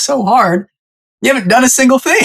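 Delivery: high-pitched, incredulous retort; hammed-up disbelief, voice shooting up; mock exasperated dad. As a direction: act out a parent's imagined outburst. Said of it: "This is what he wanted to say".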